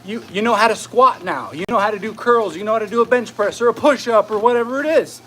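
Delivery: ordinary talk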